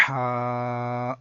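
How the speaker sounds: ہا with strong throaty h, vowel held steady; careful mid-throat H. Shown as a demonstration. Teacher models careful reading, drawn-out throaty ہا. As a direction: push ہا from mid-throat and sustain it. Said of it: "احتیاط سے پڑھنا ہوگا".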